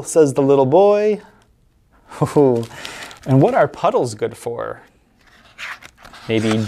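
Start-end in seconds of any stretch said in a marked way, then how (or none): none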